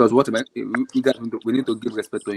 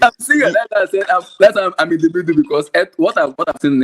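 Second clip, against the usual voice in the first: raised and emphatic; insistent protest; 6 semitones higher, 7 dB louder than usual. Real, not acted. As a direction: push back hard. Insisting over friends' objections.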